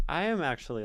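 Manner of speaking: ordinary talk, nothing marked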